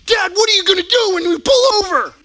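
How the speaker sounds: put-on high, loud excited kid voice; kids asking. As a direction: call out like a worried young son. Sons ask dad from back seat.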